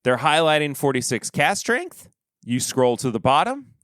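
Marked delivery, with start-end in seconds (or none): none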